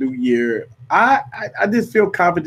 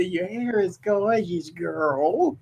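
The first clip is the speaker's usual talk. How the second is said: slow, soft creepy witch; 2 semitones higher, 7 dB quieter, much slower than usual. Retold or imitated. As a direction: purr it like a spooky witch. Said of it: "Why you put that voice on it? You sound like a creepy witch".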